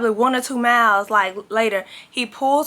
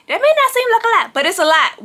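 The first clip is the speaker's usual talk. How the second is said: emphatic; 12 semitones higher, 4 dB louder, faster than usual. Real, not acted. Emphatic aside to viewers.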